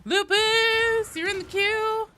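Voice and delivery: very high, loud held call. Real, not acted. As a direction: sing out the announcement on a high note. Calling a viewer into queue.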